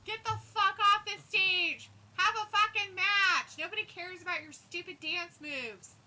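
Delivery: high, slow shouted heckling; loud drunk heckling. Pretend. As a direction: roar abuse at the stage. Heckling an imagined wrestler.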